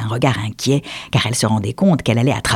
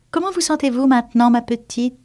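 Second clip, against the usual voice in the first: high, quicker, softening mouse; solicitous mouse; 12 semitones higher than usual. Acted. The mouse checking on Alice, high and fast. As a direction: ask kindly, a little quick.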